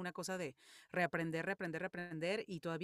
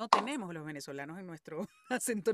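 laughing; 2 semitones higher, 12 dB louder than usual. Real, not acted. Jokes about Venezuelans' diction.

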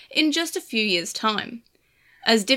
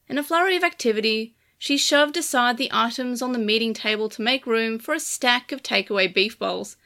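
ordinary delivery

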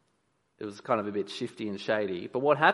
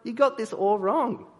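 high, raised objection; Joseph objecting; 9 semitones higher, 4 dB louder than usual. Retold or imitated. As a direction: protest loudly that it's wrong. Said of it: "Joseph's like".

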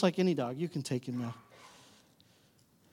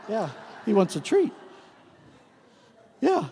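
ordinary talk, nothing marked

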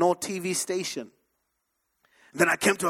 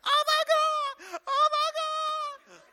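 mock wailing. Talk-show woman after fainting at the verdict, mock wailing. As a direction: imitate a woman wailing in collapse.

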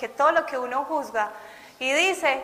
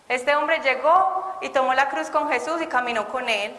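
ordinary talk

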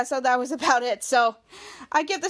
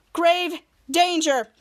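ordinary talk, nothing marked

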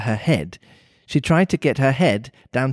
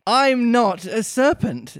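higher, slow and emphatic protest; indignant; 9 semitones higher, slower than usual. Acted. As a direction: protest indignantly as a little girl. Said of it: "said Alice indignantly".

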